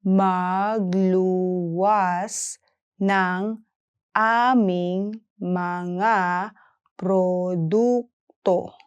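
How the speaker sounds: "Magluwas ng aming mga produkto" slowly, pausing between words; careful slow language model; slow syllable-by-syllable. Shown as a demonstration. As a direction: stretch and separate every syllable. Teacher breaks sentence down slowly.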